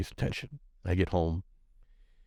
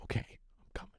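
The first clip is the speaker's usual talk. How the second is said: whisper; 13 dB quieter, much faster than usual. Retold or imitated. Father answers on phone.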